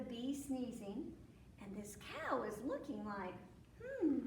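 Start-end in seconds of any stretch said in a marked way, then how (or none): 3.8-4.2 puzzled hmm. Acted. Cow looking puzzled.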